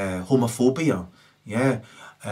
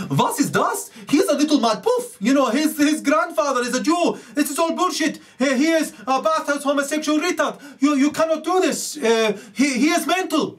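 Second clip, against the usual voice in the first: much higher, mock-outraged German ranting; a higher, caricatured German protest; 3 dB louder than usual. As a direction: ham up a shocked German gossiping. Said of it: "these Aryans were running around going".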